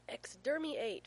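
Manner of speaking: put-on playful little-boy battle cry; gleeful yell. A narrator's reading. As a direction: yell it like a delighted toddler. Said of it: "Finn yells with a grin".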